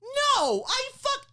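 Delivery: high, loud exasperated protest; hammed-up indignant shout; retold furious outburst. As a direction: explode in comic outrage. Retold furious comeback to doctor.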